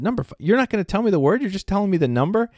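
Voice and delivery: high, fast incredulous questions; mock disbelief. Father pretending shock at his son's quiz trick. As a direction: act baffled by the trick.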